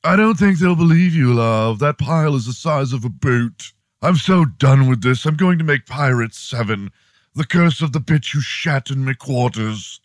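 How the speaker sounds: high, indignant British actor; hammed-up British exasperation; [name], fake British accent. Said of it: "your fake British accent".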